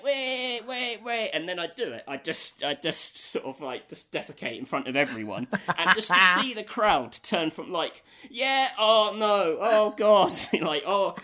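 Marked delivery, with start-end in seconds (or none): 0.0-1.3 eager crowd. Retold or imitated. Crowd voiced anticipating. 8.3-11.1 high, loud, slow mock dismay; the cheering crowd sours into horror; crowd turning to disgust. Retelling the crowd's reaction to a friend, loud mock dismay.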